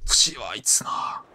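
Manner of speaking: slow unvoiced whisper. Real, not acted. Player whispering to himself while creeping along. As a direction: breathe it, barely voiced. Quiet tense moment in game.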